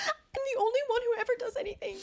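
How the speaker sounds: high-pitched wail of complaint; gasp, then whine it like a martyr; retold outburst.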